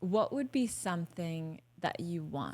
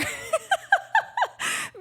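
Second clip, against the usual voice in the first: a much higher, burst-out laugh; shrieking laugh; 5 dB louder than usual. Real, not acted. Laughing at her own pointless tantrum, much higher. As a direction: laugh high at your own absurdity.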